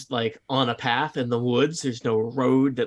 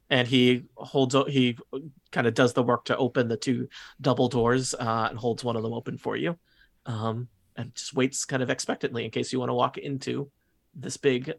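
ordinary talk

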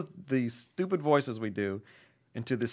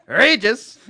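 Regis impression; 7 semitones higher, 8 dB louder than usual. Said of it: "These are impressions heard on the numerous podcasts".

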